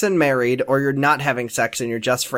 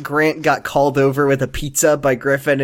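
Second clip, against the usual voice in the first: ordinary talk, nothing marked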